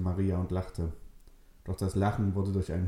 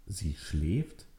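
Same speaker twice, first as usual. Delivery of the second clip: softer, rising disbelief; incredulous echo; 2 semitones lower, 4 dB quieter than usual. Acted. Nele echoes Richard's words, quiet incredulous question. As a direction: repeat it, not believing it.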